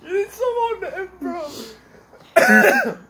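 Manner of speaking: squeaky laugh breaking through; stifled giggles. Real, not acted. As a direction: choke back the laughter. Laughing hard.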